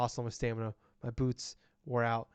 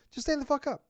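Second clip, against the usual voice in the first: much higher, exasperated command; shrieked order; 12 semitones higher, 3 dB louder than usual. Retold or imitated. Player ordering Mama to stand, high exasperated shriek. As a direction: screech the demand. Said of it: "I'm like".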